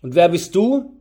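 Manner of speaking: loud challenge to a stranger; loud question. A narrator's reading. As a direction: demand it loudly, rising at the end. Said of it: "fragte laut".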